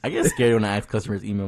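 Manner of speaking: ordinary talk, nothing marked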